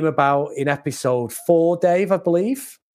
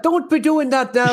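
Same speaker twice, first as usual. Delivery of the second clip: higher-pitched mock Irish accent; Banshee's awful Irish accent; 9 semitones higher than usual. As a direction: put on a bad, high Irish accent. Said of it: "trying to do an awful Irish accent".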